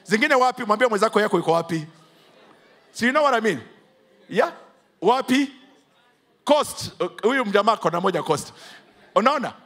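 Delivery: ordinary delivery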